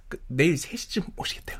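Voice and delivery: hushed aside, mid-call; whispering. Real, not acted. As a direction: whisper it so the caller can't hear. Reports aside during the phone call.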